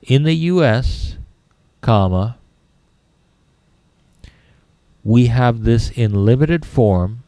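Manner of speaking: slow dictation with spoken punctuation; dictating. Shown as a demonstration. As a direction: read it out for students to copy. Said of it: "you need to write".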